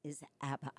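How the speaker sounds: normal talking voice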